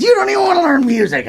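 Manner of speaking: very high, rapid scolding mother; hammed-up shrieky mom complaint; mock mom voice. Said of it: "And she said".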